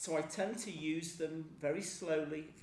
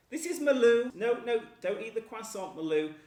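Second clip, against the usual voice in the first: higher and louder, hurried no, no; alarmed scolding; 6 semitones higher, 10 dB louder, faster than usual. Real, not acted. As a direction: stop the dog grabbing the food. Stopping dog eating croissant.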